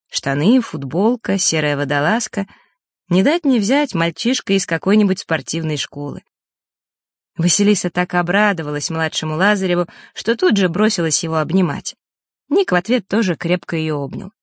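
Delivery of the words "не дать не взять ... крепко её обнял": plain reading voice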